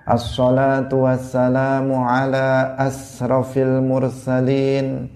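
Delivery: "asrafil" said with a plain s, slow and drawn out; wrong sin sound. Shown as a demonstration. Teacher demonstrates the mispronounced salawat with a plain s. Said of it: "dia mengatakan".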